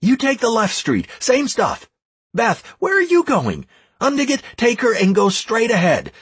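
high, urgent run of commands; shouting orders. A narrator's reading. Leader dispatching clans and advisors, shouting orders. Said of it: "I shouted to the leader of some other clan".

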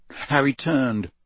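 ordinary delivery, nothing marked

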